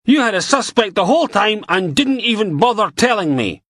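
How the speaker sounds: angry rant. Acted. Beattie ranting at Logan.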